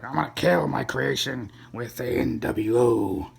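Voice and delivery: slow, drawn-out villainous declaration; Vince McMahon impression. Distraught wrestling boss vowing revenge after a loss, hammed-up impression. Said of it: "Vince McMahon was distraught ... he's like".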